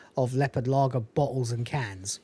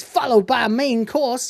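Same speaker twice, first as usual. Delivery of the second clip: higher, louder Cat impression; 8 semitones higher, 5 dB louder than usual. Cat cutting into Lister's snack talk, lightly imitated, high-pitched. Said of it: "and the cat says".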